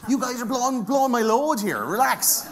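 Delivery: high, loud comic protest; exasperated high-pitched telling-off, played for laughs; mock exasperation. Mock exasperation at interrupting audience.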